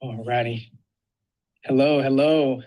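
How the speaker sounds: ordinary talk, nothing marked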